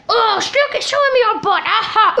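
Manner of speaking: usual speaking voice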